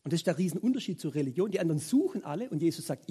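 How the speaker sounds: ordinary delivery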